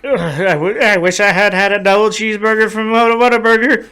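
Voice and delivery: high, laughing, pleading whine; whiny regretful voice. Pretend. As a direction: whine it like your last wish. Imagined dying man's last regret.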